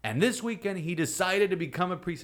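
put-on Spanish-accented priest tells the retreat the boy's news; thick Spanish accent. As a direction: proudly announce the news in a heavy Spanish accent. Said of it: "in his thick Spanish accent".

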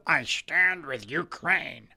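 slow, low, soft sneering parody of the slogan; mocking slogan. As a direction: drag it out to mock them.

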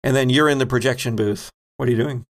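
ordinary talk, nothing marked

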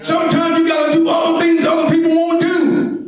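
high, shouted pulpit exhortation; full-force preaching. Acted. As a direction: shout it out to the congregation.

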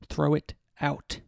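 slow, hushed, threatening whisper; menacing whisper. A narrator's reading. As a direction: breathe the order out slowly like a threat. Husband repeating his order, menacing.

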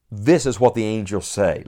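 normal talking voice

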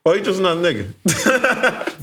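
high, slow, laughing mockery; laughing mock echo. Friend repeats the phrase, laughing and mocking. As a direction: tease him while laughing.

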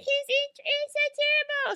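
very high wailing shriek; hysterical horror scream. Acted. Ruby confessing to onlookers, hammed-up hysterical wailing scream. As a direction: wail it like a sobbing horror heroine. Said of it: "horror movie scream".

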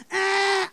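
held buzzer tone; buzzer imitation. Preacher shows what she heard inside her, held buzzer noise. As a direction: imitate a quiz buzzer going off. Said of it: "J'ai entendu cette".

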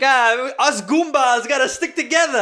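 high, loud Italian-American lady impression; excited goomba lady. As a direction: belt it out as his new paesana. Lady bonding as fellow Italian.

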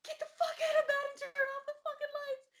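high, fast, strangled mock scream; retold frantic order. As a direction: rush it out in imitated panic. Retelling frantic order to turn lights.

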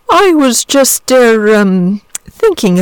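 slow, hesitant, lightly voiced dragon; a just-woken dragon fumbling for words; simple dragon. A narrator's reading. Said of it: "he said in his simple way".

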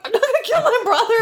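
high, laughing, indignant; mock indignant kid. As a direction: protest like a squeaky kid. Sam quote, high, laughing.

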